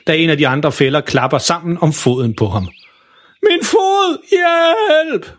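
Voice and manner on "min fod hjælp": yelling for help. Acted. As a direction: call for help, foot stuck in a trap. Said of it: "råber han".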